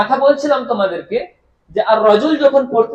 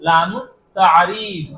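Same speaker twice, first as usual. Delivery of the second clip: লামুত tariff drawn out slowly, every syllable clear; slow term; 3 semitones lower, much slower than usual. Shown as a demonstration. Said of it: "কি বলা হবে?".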